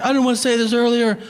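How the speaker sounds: high, mock-overjoyed proposal; imagined gushing excitement. Comedian imagines waiter proposing a shared future, mock gushing. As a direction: blurt it like a giddy new best friend.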